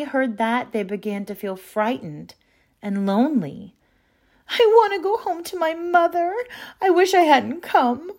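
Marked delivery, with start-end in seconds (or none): 4.5-8.1 put-on high, whiny little girl's wail. A narrator's reading. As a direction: wail for mother on the edge of tears. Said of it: "wailed Mary Virginia".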